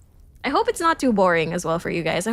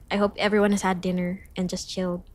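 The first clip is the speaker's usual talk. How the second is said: ordinary talk, nothing marked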